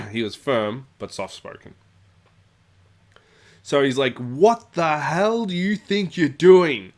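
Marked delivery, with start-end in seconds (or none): 4.2-6.9 slow, loud, higher angry yell. Retold or imitated. Ian yelling across the club at the bully. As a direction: bellow it across the room. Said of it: "he's actually yelling over the top of everyone".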